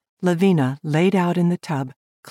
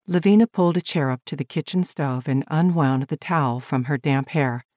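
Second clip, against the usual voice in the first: plain reading voice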